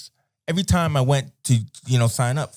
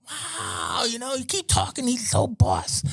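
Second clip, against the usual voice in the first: imitating wowed kids, much higher and softer; awestruck schoolkids; 12 semitones higher, 6 dB quieter, slower than usual. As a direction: mimic pupils gushing over the new teacher. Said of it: "kids like this".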